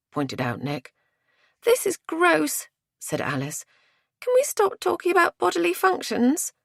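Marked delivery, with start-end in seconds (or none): 1.6-2.7 high, drawn-out disgust. A narrator's reading. Alice complains about the gruesome talk, disgusted. 4.2-6.5 very high squeamish complaint; squeamish protest. A narrator's reading. Alice begs the others to change subject, squeamish. Alice objects to grisly forensic talk.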